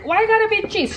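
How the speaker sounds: ordinary delivery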